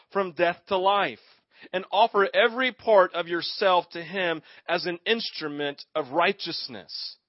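steady, rising emphatic reading; scripture reading. Acted. Preacher reading Romans to the church, building emphasis. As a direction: read the verses to the congregation with weight.

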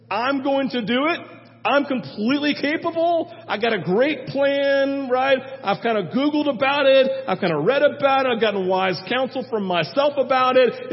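hammed-up cocky confidence; cocky Saul. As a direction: brag like nothing can go wrong. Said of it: "He said".

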